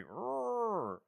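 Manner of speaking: a terrible mummy growl, half-hearted; mummy roar. Acted. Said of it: "That's a terrible impression of a mummy".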